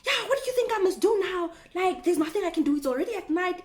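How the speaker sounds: high, loud imitated outburst; mock annoyed man. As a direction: mimic the guy snapping at her. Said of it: "now he goes off on me".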